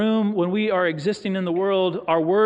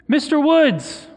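drawn-out, high, loud call across a room; retold yell; 8 semitones higher, 6 dB louder, slower than usual. Stranger calling to someone across the bank, yelling. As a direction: yell the name slowly, imitating someone calling out. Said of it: "start yelling".

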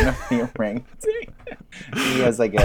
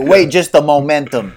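louder mock chimp, misusing the word; hammed-up tough chimp; dad chimp; 5 semitones higher, 7 dB louder than usual. Dad chimp interrupting, louder mock tough guy. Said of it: "He'll be like".

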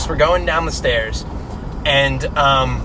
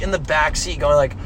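ordinary talk, nothing marked

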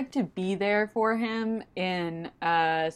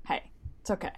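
softer and softer, down to a whisper; soothing comfort; 5 semitones lower, 10 dB quieter, faster than usual. Pretend. Imagining comforting Cass, soft reassuring whisper. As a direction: hush him gently, like calming someone upset.

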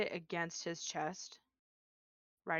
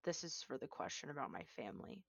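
quiet menace; 4 semitones lower, 7 dB quieter, faster than usual. Acted. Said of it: "puts her mouth right next to his ear".

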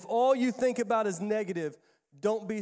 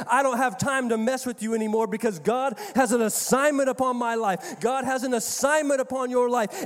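building, louder preaching; 4 semitones higher, 6 dB louder than usual. Real, not acted. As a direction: preach it with rising force. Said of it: "I'm about to start preaching".

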